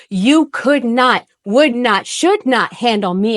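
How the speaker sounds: slow sing-song chant of could not, would not, should not; Doctor Seuss rhythm. Retold or imitated. Mocking a guy who hit on her with a Seuss rhythm. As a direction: lean into the Seuss beat, each not landed. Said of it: "I use Doctor Seuss".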